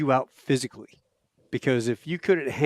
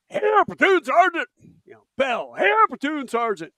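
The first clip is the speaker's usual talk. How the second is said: much higher, loud sound-off; recruit sounding off; 13 semitones higher, 4 dB louder than usual. Retold or imitated. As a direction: shout it at attention. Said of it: "he's like".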